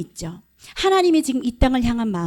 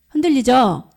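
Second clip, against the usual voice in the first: louder, emphatic prompt; 5 dB louder than usual. Real, not acted. Preacher repeats to draw the congregation's amen, emphatically. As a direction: push 흔들리죠 out firmly to rouse the room.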